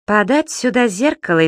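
grand pronouncement. A narrator's reading. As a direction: announce it like a little king. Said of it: "изрёк вдруг Зубастик".

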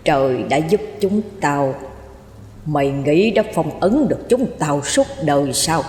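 deep, dull spectral menace; low, muffled ghost voice. A narrator's reading. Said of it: "tiếng trầm đục, nghe như tiếng đài cassette".

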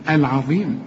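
العظيم with a thin, fronted vowel after ع; light ayn. Shown as a demonstration. Teacher modelling the rule just given, light ع in العظيم. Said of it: "رقق كل عين في القرآن".

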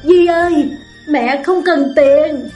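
mother begging her daughter not to leave, distraught; startled plea. Acted. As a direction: call out to stop her leaving. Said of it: "Tiếng mẹ thảng thốt".

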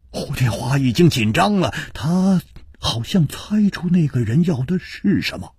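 ordinary delivery

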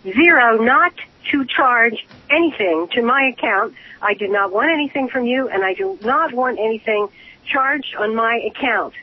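ordinary talk

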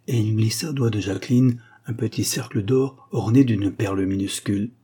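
ordinary delivery

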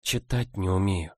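barely voiced, ashamed confession; almost soundless. A narrator's reading. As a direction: breathe the words out. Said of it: "произнесла почти беззвучно".

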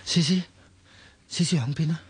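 shouting a name, looking for her; calling out. Acted. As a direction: call out anxiously across the room. Calling for missing friend.

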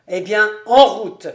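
loud, rousing send-off; rally a friend to set off, loudly; exclamation. A narrator's reading. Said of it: "s'écria Hahn". (s'écrier avec entrain)

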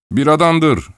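high, loud blustering cry; shout. A narrator's reading. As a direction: bellow it like a tipsy clerk. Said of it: "diye bağırdı Hindi".

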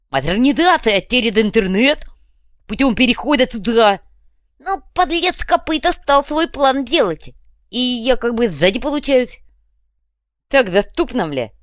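high, loud lisping rant; blustering fuhrer. A narrator's reading. Fuhrer explains his stolen plan, blustering. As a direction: hammer out muddled jargon with grievance.

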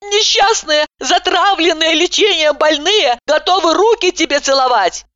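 high, loud scolding; indignant rant. A narrator's reading. Patient keeps ranting at the doctor. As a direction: keep hammering her with outrage.

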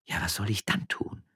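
plain reading voice

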